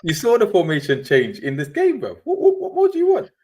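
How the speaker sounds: high, loud indignant whoa; mock outrage. Real, not acted. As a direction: protest with laughing disbelief. Defending manager, mock outrage.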